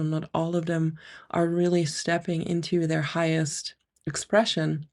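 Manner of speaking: usual speaking voice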